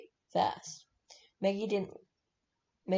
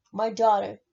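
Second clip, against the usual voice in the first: loud, startled protest; sudden burst of parental alarm; alarmed exclamation; 9 dB louder than usual. A narrator's reading. Father alarmed at threat to daughter.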